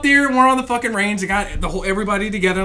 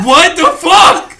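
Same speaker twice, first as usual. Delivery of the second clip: loud, high disbelieving cry; mock outraged protest; 6 semitones higher, 7 dB louder, slower than usual. Recruit asking what he did, retold as loud high protest. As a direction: protest loudly, pitch thrown up.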